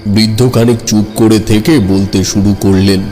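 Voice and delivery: plain reading voice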